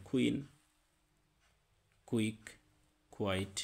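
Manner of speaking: কুইন কুইক কোয়াইট with kw; qu sound. Shown as a demonstration. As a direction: round the lips after k in কুইন. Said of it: "কিউ এর উচ্চারণটা হবে কিউ".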